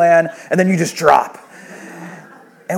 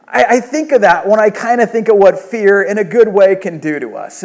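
normal talking voice

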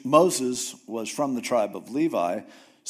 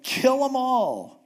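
mock fierce Moses; 10 semitones higher, 3 dB louder, slower than usual. Moses imitated wanting destruction.

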